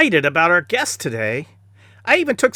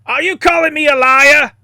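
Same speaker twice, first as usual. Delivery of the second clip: sharp offended challenge, louder and higher; angry; 7 semitones higher, 6 dB louder than usual. Acted. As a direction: bristle at the insult. Seagal takes offense.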